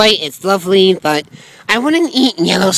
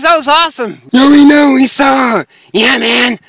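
ordinary talk, nothing marked

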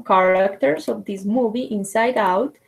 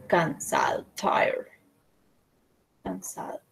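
tired whisper; 5 semitones lower, 9 dB quieter than usual. Shown as a demonstration. Teacher acting out tired for the class, whispered. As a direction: whisper it, worn out.

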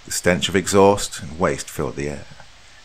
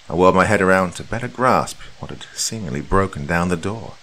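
plain reading voice

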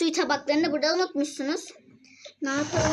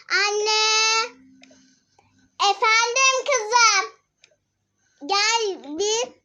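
calling out; 8 semitones higher, 5 dB louder, much slower than usual. Real, not acted. Kids calling mother from bathroom.

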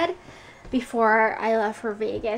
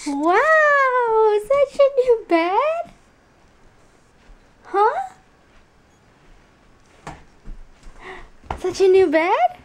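gasping, much higher and louder; 9 semitones higher, 3 dB louder than usual. Real, not acted. Showing toddler her new bed.

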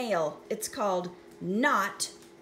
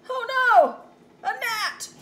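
high, loud alarmed cry; 11 semitones higher, 8 dB louder, slower than usual. Acted. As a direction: panic at the gnat. Character alarmed by gnat.

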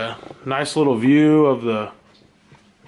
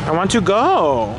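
impatient whine; 5 semitones higher, 3 dB louder, slower than usual. Real, not acted. Impatient while waiting to cross.

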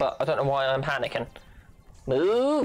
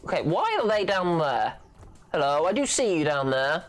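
ordinary delivery

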